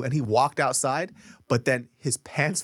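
ordinary delivery

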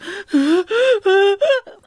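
a sudden high, loud gasping cry; imitated toddler wail. Parent imitating her daughter's grocery store meltdown, high and loud gasping cry. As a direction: mimic a kid about to lose it over gummies.

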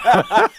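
high laughing burst; burst of laughter. Real, not acted. Laughing at joke voice remark.

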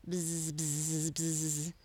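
steady droning buzz; grumbling bee buzz. A narrator's reading. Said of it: "grumbled Bumble the Bee".

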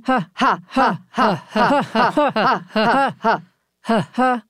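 staccato diaphragm ha ha; laughing sound exercise. Shown as a demonstration. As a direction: pump each ha from the belly. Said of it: "Are you feeling it go in?".

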